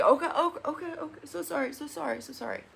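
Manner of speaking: high, fading mumble of sorry; high voice trailing off into soft sorrys; sheepish apology. Retold or imitated. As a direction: back down fast and go small. Mitch caving, apologizing.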